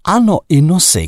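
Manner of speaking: high, loud outburst; shouting. A narrator's reading. Narrator shouting back at the woman about noses, loud. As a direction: shout it, losing composure. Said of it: "křičel jsem už".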